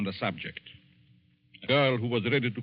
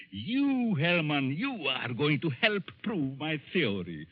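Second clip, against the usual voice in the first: higher, drawn out and menacing, then a cackle; gloating madman; 7 semitones higher than usual. Acted. Mad professor taunting his doomed victim, gloating. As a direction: savour his victory like a villain.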